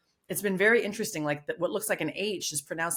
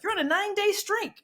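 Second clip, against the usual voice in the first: high, chirpy app announcer; 13 semitones higher than usual. Retold or imitated. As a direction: announce the streak like a cheerful game. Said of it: "so it's like".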